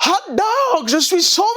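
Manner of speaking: high-pitched, happy Hot dog, je suis sauvé; saved teen repeats his joyful cry all the way home. Retold or imitated. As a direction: sing it out high with delight. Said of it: "il dit".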